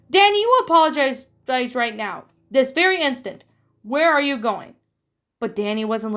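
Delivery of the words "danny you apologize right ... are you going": higher, louder stern demand; angry mother. Acted. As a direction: order him back as he storms off. Mother orders Danny to apologize.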